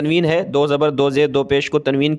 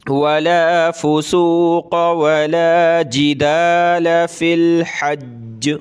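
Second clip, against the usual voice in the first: slow chanted वला फुसूक वला जिदाल, linked without a break; slow tajweed chant; rejoined recitation; 2 semitones higher, much slower than usual. Shown as a demonstration. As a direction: recite it again joined from behind. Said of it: "दोबारा पीछे से मिलाकर पढ़ूँगा".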